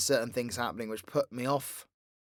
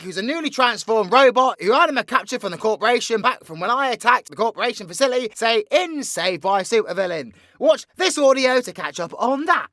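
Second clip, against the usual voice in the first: high, loud, rapid-fire villain storytelling; excitable theatrical villain rattling off a plot; villain's breakneck recap; 12 semitones higher, 11 dB louder than usual. Acted. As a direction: race through your exploits with showman's gusto. V recaps the series in character.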